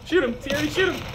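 high urgent calling out; urgent shout. Real, not acted. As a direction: yell at a teammate. Urging teammate.

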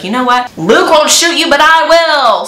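hammed-up sneer, tossing the threat out; mock villain threat. Scheming wife threatens to shoot Hector herself, hammed-up and high.

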